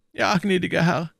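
timid landlord. A narrator's reading. Innkeeper nervously answering Athos, timid and high. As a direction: answer meekly, a touch anxious. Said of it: "Der Wirt trat ängstlich näher".

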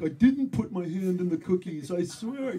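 put-on high, loud little-kid protest; protesting four-year-old. Flustered man sounding like a child denying guilt, high protest. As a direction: whine a denial like a small child caught out. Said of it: "I sound like a four-year-old".

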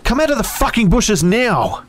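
high, loud furious demand; strained, high-pitched enraged yell; angry yelling. A narrator's reading. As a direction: shout him out of hiding. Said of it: "was angry".

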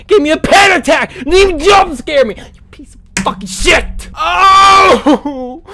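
loud frustrated shouting; angry yelling. Real, not acted. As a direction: yell at the game in outrage. After the jump scare.

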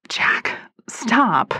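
breathy whispered plea. Acted. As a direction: whisper it, pushing him away. Katie resisting Jack in car.

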